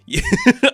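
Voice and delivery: loud, high, brief cackle; amused laugh. Real, not acted. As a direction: crack up for a moment. Laughs at the retold scene.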